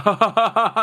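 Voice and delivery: loud, high mock laugh; imitated laugh-off. Retelling friend brushing off tattoo question, loud mock laugh. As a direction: ham up a dismissive chuckle. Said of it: "he laughed it off, like".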